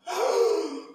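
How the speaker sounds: a sharp, high intake of breath in wonder; amazed gasp. Retold or imitated. Preacher reliving his discovery with a gasp of wonder. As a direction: gasp as if the answer just hit you.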